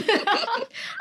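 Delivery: quick laugh that rises high; rising laugh. Real, not acted. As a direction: laugh along delighted. Shared Harry Potter laugh.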